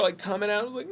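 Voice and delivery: usual speaking voice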